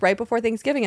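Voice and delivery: usual speaking voice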